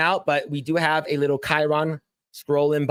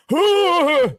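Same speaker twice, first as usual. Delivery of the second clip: high giggle; 13 semitones higher, 6 dB louder than usual. Retold or imitated. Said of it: "you hear Gandalf go".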